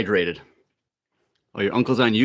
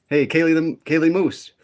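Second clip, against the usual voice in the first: bright, higher excited hello; excited greeting; 6 semitones higher, 3 dB louder than usual. Real, not acted. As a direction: call out a happy greeting. Greeting a viewer by name.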